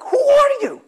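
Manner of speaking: high, loud startled "who are you"; startled demand. Acted. Worker snapping at the guy who keeps knocking, high and loud. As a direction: jump up in pitch, caught off guard and irritated.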